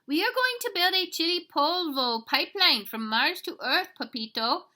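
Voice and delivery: high, loud little voice with Spanish flavour; tiny excited chihuahua. Acted. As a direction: announce the gang proudly. Smallest dog tells the plan.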